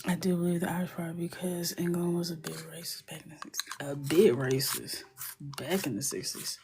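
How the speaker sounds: soft unvoiced whisper narration. Whispering to viewers while coloring with markers. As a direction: whisper the steps like an ASMR tutorial.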